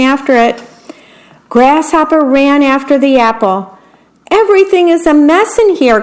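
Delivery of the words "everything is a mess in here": fast, high complaint; rattled worm wailing inside; flustered cry. A narrator's reading. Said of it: "cried the worm".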